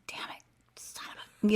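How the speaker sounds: whispered swearing under the breath; quiet cursing. Retold or imitated. Hurt passenger sitting in her seat, quietly cursing to herself. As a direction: curse to yourself in a pained whisper. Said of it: "still quietly cursing to myself".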